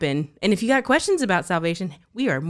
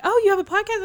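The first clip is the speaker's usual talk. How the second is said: much higher, louder surprised sister-in-law; high surprised quote; 6 dB louder, faster than usual. Retold or imitated. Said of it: "my sister-in-law was like".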